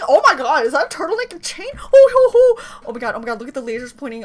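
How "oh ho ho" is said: loud, high hooting; excited whooping. Real, not acted. Fan reacting to the outfit, whooping. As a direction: whoop in short bursts.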